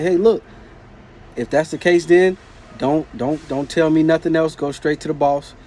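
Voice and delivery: loud, high angry yelling at the coworker; heated shout. Retold or imitated. Telling off a dismissive coworker, loud angry shout. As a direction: yell it, fed up and done. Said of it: "Told him just like that".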